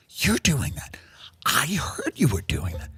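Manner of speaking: hushed, knowing whisper; gossipy whisper. Acted. Guest whispering knowingly to another at the lodge.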